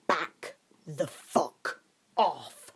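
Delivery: very slow, menacing snarl. A narrator's reading. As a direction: drag each word out as a threat. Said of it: "he snarled in a voice Harry had never heard before".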